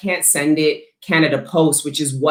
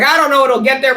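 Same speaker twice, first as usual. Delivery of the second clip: mock shipping clerk; 10 semitones higher, 6 dB louder than usual. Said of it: "It's like".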